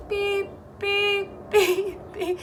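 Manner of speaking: high squeaky beep beep of a truck backing up; reversing truck beep. Acted.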